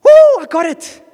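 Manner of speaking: high, drawn-out whoop of triumph; excited boy. Acted. Boy runs into the store on finding his boat, excited. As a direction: cheer loudly like an overjoyed kid. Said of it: "he runs in and he's excited".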